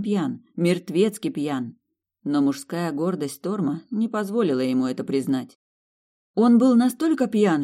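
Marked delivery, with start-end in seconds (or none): 6.3-7.6 raised and much higher; insistent. Acted. Woman convincing her fiancé, eager and insistent. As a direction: push the point eagerly. Heroine insists, pitch high.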